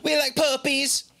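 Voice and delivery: high goofy voice. Pretend. Mock cheerful voice.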